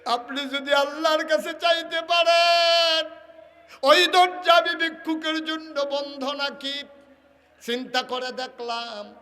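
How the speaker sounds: high, shouted chant with stretched endings; beggar's realisation as impassioned sermon cry; full-force preaching. Acted. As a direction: belt it out on a held high note.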